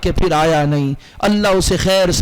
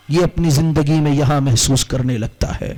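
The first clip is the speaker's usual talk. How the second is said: ordinary delivery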